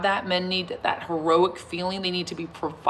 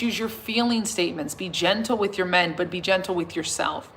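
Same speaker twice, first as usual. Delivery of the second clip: ordinary delivery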